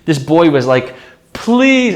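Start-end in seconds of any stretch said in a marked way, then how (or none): 1.3-2.0 put-on begging child. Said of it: "he was, like, crying".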